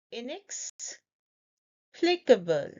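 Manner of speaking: "Inexplicable" slowly, pause after "inex"; broken into parts. Shown as a demonstration. Teacher breaking down the pronunciation, slow in two parts. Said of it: "I will break down the pronunciation".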